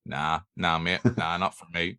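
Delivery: low, dismissive Belfast refusal; lightly voiced star saying no; Hugh Jackman. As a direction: wave it away in a low Belfast voice. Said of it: "why Hugh Jackman has a Belfast accent".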